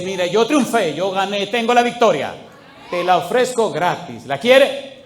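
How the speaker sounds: loud, high, triumphant proclamation; full-force preaching. Real, not acted. Preacher offers Christ's victory to congregation, loud and triumphant.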